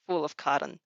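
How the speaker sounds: ordinary delivery, nothing marked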